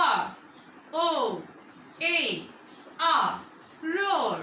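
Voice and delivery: letters R O A R spaced with pauses, then "roar"; teacher spelling "roar" for the class; spelling aloud. Shown as a demonstration. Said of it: "Say with me".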